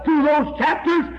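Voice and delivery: rising, slowing revival cry; preaching at full force. Acted. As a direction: lift it up like a camp-meeting preacher. Preaching climax over music.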